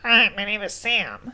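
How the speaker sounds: nasal mock audiobook voice; Sam. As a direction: talk through the nose as if pinched. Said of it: "put my hand on my nose to do that".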